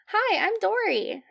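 put-on high, innocent child fish voice; little Dory. Young fish introducing herself to others, innocent high voice. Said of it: "she's like".

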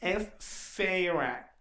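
"Sarah" drawn right out the English way; stretched anglicized "Sarah", pretentious; mock pedantic correction. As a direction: imitate a snooty corrector, very slow "Sarah". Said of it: "llega esta morra de".

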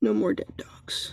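soft unvoiced whisper, slow. Real, not acted. Librarian whispering a book title back to herself. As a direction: breathe the title back to yourself.

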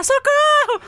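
high mock cry for help; calling for help. Calling neighbors to help during the dogfight, high shout. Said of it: "E eu comecei a gritar".

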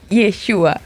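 Yeshua with its accent on U; stress on the U. Shown as a demonstration. Student shows she is learning, Yeshua stressed on U. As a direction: practise Yeshua as ye-SHU-a. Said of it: "Ya voy aprendiendo".

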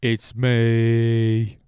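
loud, belted hello in a put-on [name] voice; [name] impression. Host opens his podcast imitating [name], loud and put-on. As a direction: croon the greeting like the famous ballad opening. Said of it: "That's my impression of [name]".

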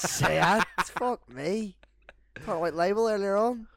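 loud, high laughing outburst; real delighted laughter; laughing loudly. Real, not acted. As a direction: crack up and shout through it. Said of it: "laughing at the joke".

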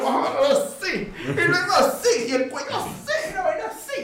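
high-pitched, laughing mock struggle; mock beaten yelps. Actor retells his staged beating to friends, mock yelps. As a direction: squeal through a hammed-up beating. Reenacts being beaten up on set.

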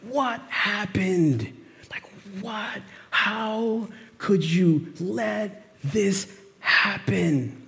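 hushed, slow, stricken; grieving sisters shaking Jesus, hushed and slow; anguished hush. Retold or imitated. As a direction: ask it barely above a breath, slowly. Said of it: "And they're like".

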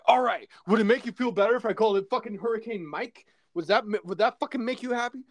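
mock fed-up husband, rattled off quickly; exasperated. Husband gives in to his wife over the hurricane name, exasperated.

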